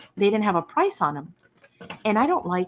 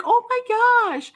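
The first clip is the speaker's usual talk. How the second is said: high, drawn-out gasp of delight; retold over-the-top amazement; amazed; 5 dB louder, slower than usual. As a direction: stretch it out high and loud. Retelling amazement at the bargain.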